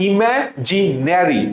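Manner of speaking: ইমাজিনারি drawn out slowly as ইমাজিনেরি; slow spelling drill. Shown as a demonstration. Teacher drilling the pronunciation with students, slowly. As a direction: stretch ইমাজিনারি out. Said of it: "উচ্চারণ করবো কিভাবে".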